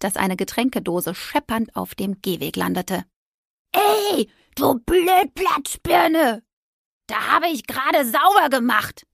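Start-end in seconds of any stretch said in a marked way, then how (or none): 3.7-9.1 much higher, slow, shouted insult; furious scream. Acted. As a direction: scream it after the driver in rage. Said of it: "schrie sie dem Fahrer hinterher". (wütend dem Fahrer hinterherschreien)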